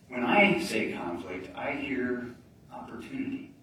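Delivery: slow, softened turn of the phrase; slow down and land the twist; slow and deliberate. Shown as a demonstration. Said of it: "you're gonna think I'm nuts".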